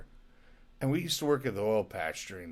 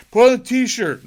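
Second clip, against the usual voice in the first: high, loud repeated demand; insistent shout; 13 semitones higher, 15 dB louder than usual. Retold or imitated. Buddy yelling at his friend to put on the t-shirt, loud insistent demand. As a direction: yell it at him again, louder. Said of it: "He's like".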